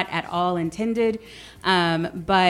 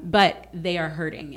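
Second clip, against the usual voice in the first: usual speaking voice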